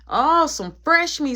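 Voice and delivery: loud, high, drawn-out jeer; taunting inmate. Retold or imitated. Dude greeting the new arrival, loud drawn-out taunt. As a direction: imitate a dude jeering at a newcomer.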